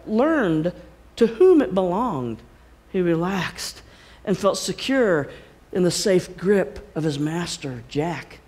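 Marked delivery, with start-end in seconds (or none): none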